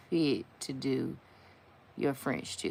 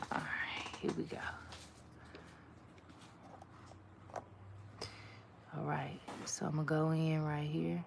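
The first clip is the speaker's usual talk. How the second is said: focused whisper while painting a nail; 12 dB quieter than usual. Real, not acted. A nail artist whispering over the tricky line. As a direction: whisper it, concentrating on the fine work.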